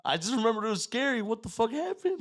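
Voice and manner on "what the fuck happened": stunned disbelief. Retold or imitated. Recalling the scary experience.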